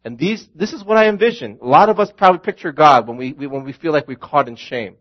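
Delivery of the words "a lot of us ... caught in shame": normal talking voice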